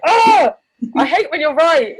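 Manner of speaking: high and loud playful complaint; mock frustrated whine. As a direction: whine it like a sore loser, laughing at yourself.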